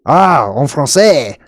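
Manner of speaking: drawn-out, savoured French phrase; slow, theatrical French flourish; French accent. Acted. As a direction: relish it in a put-on French accent. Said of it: "Now I get to do my French accent".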